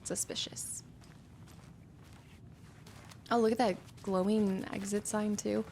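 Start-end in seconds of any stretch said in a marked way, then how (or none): 0.0-0.8 soft, suspicious whisper. Real, not acted. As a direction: whisper it conspiratorially. Muttering at locked door.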